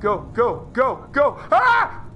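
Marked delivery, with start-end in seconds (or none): 1.5-2.0 short high scream of fright; sudden startled yelp. Real, not acted. Player scared by monster's arm, startled scream. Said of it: "I don't know why I screamed".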